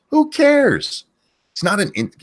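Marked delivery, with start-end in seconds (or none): 0.1-1.0 loud, very high, fed-up cry; exasperated. Real, not acted. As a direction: throw it out in exasperation. Rant, exasperated.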